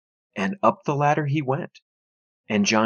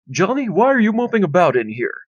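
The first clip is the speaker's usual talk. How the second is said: high, louder dad voice; gruff father; 7 semitones higher, 3 dB louder than usual. A narrator's reading. Father questioning his moping son, louder. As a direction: ask like a puzzled father.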